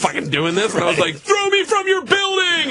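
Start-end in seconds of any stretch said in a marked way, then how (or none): 1.1-2.7 high, slow mock-heroic declaration; hammed-up brave cry, much higher and drawn out; mock bravado. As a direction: ham up the fearless daredevil line.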